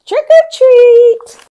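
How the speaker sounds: slow, very high and loud sing-song shout; shouting together. A narrator's reading. Children at the door shouting in chorus, gleeful. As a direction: draw it out like a gang of excited kids. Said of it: "all shouting together".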